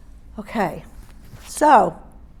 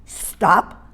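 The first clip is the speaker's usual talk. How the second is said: short, sharp "Stop"; abrupt trainer's command; 2 semitones lower, 4 dB louder than usual. Shown as a demonstration. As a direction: halt the dog with one firm word. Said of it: "Did you hear the change in my voice?".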